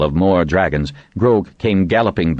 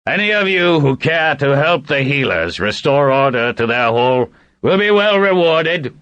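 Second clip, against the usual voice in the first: shouting to the crowd; 10 semitones higher than usual. A narrator's reading. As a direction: call it out over a crowd from the saddle. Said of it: "he shouted".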